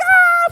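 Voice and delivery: high, loud acted shriek; terrified scream. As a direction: shriek as if being whipped mid-fall. Screaming as strap catches wind.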